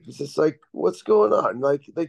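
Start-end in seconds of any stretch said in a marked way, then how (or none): 0.7-1.6 higher-pitched attempt at a French accent; bad French accent. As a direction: imitate the coach's French accent, badly. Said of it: "that was the worst French accent ever".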